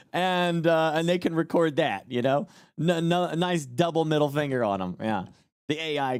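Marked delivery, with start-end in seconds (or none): none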